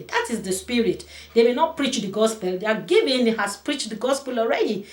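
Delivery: fervent preacher, rising; rising preaching. Acted. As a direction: build the preaching to a peak. Preaching builds to full force.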